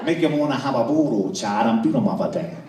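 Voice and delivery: thick, mushy Louisiana dialect, words run together; New Orleans waitress. Acted. Waitress repeating herself to a baffled customer, loud thick New Orleans accent. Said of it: "And she said".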